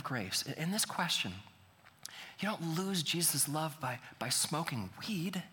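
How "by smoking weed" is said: ordinary delivery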